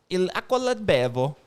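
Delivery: slow, exaggerated Italian accent; hammed-up Italian accent; put-on Italian accent. Shown as a demonstration. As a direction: lay the Italian accent on, taking your time. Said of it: "do the accent like that".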